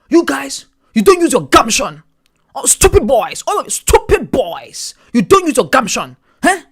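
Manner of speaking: scolding math teacher. Retold or imitated. Math teacher scolding boys, loud mock accent. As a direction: berate the stupid boys. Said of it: "be like".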